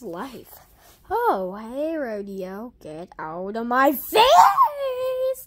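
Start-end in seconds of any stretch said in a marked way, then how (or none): 2.8-5.5 slow, loud shout broken by laughter; laughing shout. Acted. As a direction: yell it while laughing.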